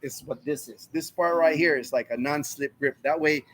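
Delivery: ordinary talk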